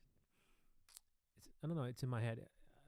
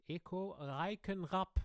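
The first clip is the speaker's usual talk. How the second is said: high, drawn-out German stage introduction; emphatic German MC with rolled r; German announcer; 7 semitones higher, 3 dB louder, slower than usual. Retold or imitated. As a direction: announce the name in a showy German accent. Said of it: "in Germany".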